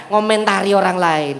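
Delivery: ordinary delivery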